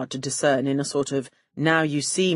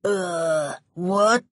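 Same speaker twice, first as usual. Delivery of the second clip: dim thug noises; 2 semitones higher than usual. Retold or imitated. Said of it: "making woefully inarticulate ... noises to each other".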